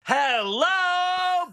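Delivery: drawn-out, high stagey hello; showbiz hello. Pretend. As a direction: greet like a vaudeville star. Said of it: "The hello really got me".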